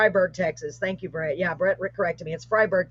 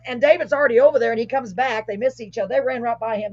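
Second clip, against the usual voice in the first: ordinary delivery, nothing marked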